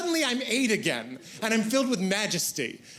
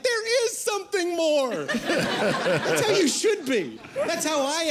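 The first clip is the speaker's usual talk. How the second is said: high, wide-eyed believer; a lightly voiced girl full of wonder; dreamy girl voice; 8 semitones higher, slower than usual. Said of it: "They're like".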